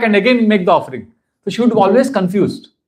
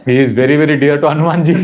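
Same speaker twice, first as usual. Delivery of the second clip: laughing; 2 semitones lower, faster than usual. Retold or imitated. Laughing through recalled thought.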